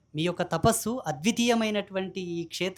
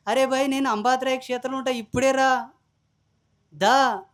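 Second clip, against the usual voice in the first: raised, louder defiant dare; shouted challenge; 4 semitones higher, 5 dB louder than usual. Retold or imitated. Preacher daring a threatening caller to come, loud challenge.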